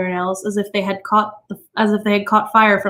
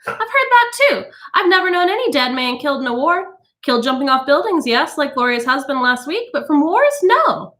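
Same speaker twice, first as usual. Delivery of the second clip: high, chatty society woman; gossiping guest; 6 semitones higher than usual. A narrator's reading. A guest chiming in about dead husbands, chatty. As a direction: chime in lightly.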